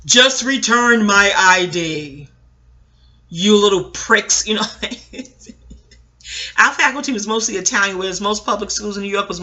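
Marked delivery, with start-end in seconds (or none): 0.0-4.5 slow, loud, drawn-out school announcement; angry PA announcement. Retold or imitated. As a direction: bark it slowly over the school speakers like a furious staff member. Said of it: "over the P A system".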